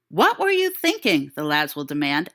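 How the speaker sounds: ordinary delivery, nothing marked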